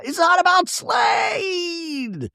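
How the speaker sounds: high drawn-out shriek, slowed; hammed-up meltdown scream; screaming nonstop. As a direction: wail it at the top of your voice. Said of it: "she's screaming nonstop".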